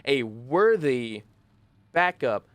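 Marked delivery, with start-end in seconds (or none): none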